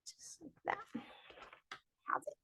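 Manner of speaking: soft whisper under the breath. Real, not acted. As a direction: whisper it to yourself. Whispered aside while drawing.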